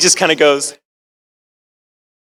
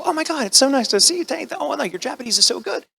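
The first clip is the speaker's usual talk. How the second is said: mock gushing in a much higher voice; gushing guests; 12 semitones higher, 4 dB quieter than usual. Delighted Japanese guests greet Brandon, mock gushing. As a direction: imitate delighted guests fawning, high-pitched. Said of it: "And they just go".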